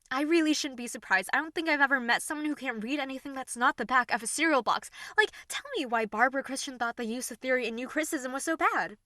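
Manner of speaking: loud, fast, furious lecturing; angry rant. Acted. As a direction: tear into them with contempt.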